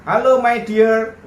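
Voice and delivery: slow, sugary "Halo, my dear" with a drawn-out Halo; mock the scammer's honeyed greeting; imitated smooth sweet talk. Scammer sweet-talking the victim on the phone, slow and syrupy. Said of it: "Ngomongnya sudah mulai halus".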